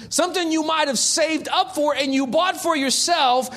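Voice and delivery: ordinary talk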